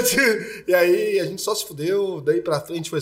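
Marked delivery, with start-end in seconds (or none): none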